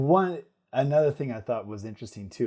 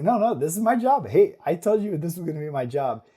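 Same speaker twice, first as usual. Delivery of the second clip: higher, louder retold protest; eager insistence; 6 semitones higher, 6 dB louder than usual. Tired dad telling his wife it is his job, insistent. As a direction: insist on it, voice jumping up.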